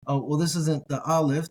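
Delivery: ordinary talk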